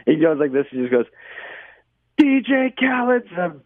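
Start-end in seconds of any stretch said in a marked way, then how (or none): 2.2-3.5 high, slow mock yell; imitating the heckler. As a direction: call it out like a proud street heckler. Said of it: "like that".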